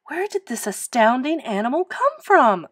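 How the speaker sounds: flabbergasted question; astonished cry. A narrator's reading. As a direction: cry out in wonder, climbing high. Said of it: "cried Miss Severs".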